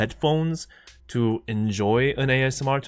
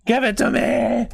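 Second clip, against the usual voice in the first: demanding shout; 11 semitones higher, 4 dB louder than usual. Real, not acted. Demanding again, louder.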